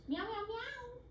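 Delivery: high mock meows; bird meowing. Parrot asked about the cats, answering with high meows. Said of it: "He says that too".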